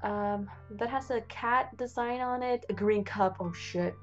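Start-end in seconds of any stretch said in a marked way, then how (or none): none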